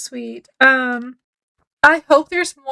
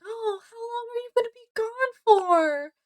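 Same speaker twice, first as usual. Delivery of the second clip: much higher, softer voice; imitated Osano; 10 semitones higher, 7 dB quieter than usual. Osano asking Kiki about her trip, in a high put-on voice. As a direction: put on a high, gentle voice for the question.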